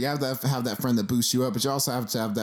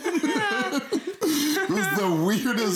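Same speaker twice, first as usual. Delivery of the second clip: laughing; 13 semitones higher, much slower than usual. Real, not acted. Laughing at friend's boast.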